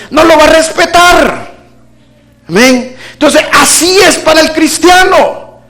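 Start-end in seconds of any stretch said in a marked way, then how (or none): 0.1-1.5 forceful shout. Real, not acted. Preacher driving home point.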